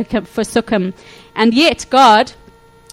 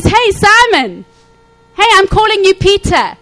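excited greeting; 10 semitones higher, 5 dB louder than usual. Retold or imitated. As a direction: greet him like a long-lost friend, elated. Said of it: "he's so excited about".

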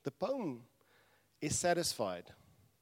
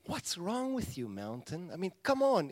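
mock exasperated ribbing, higher; elements teasing the mountain; 6 semitones higher, 4 dB louder than usual. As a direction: needle a friend who's overreacting. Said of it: "And they go like".

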